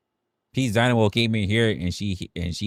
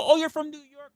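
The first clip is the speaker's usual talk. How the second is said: high, awed "oh" of someone dazzled; impressed fan voice. Retold or imitated. Mock fan gushing at a New York City native. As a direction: gush like a fan meeting a New Yorker. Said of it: "and they go".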